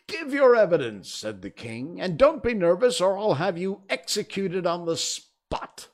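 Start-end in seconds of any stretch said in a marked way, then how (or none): 0.1-1.1 high, loud royal order; ringing courtroom command; commanding king. A narrator's reading. King orders a nervous witness. 2.0-5.9 loud, high royal threat; blustering, raised-voice ultimatum; threatening king. A narrator's reading.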